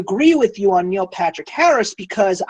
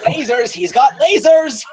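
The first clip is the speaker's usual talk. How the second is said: high, drawn-out mock exclamation with laughter; mock-excited quote; 6 semitones higher, 4 dB louder than usual. Acts out film line, laughing.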